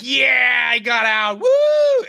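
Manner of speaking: high, loud cheer of escape, ending in a shrieking woo; triumphant whoop. Retold or imitated. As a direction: shout it like you just got away. Escaping through the portal, celebrating.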